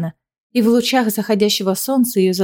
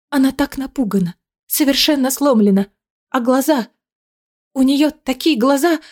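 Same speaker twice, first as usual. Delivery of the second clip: voice breaking; 4 semitones higher than usual. Acted. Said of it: "Голос Дианы срывается".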